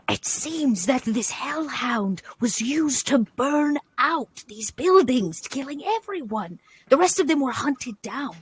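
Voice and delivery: soft squirrel voice. Acted. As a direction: report grim findings in a small, careful voice. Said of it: "softest squirrel voice".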